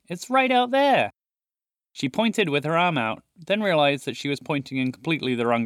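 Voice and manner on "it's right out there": high, loud excited cry. Acted. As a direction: shout it out thrilled. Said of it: "she said excitedly".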